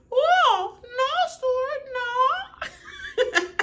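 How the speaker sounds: very high, crying falsetto wail of no; Stewart's mom impression. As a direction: whine like a sobbing sitcom mom. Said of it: "Stewart's mom from Mad T V".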